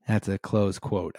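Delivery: ordinary talk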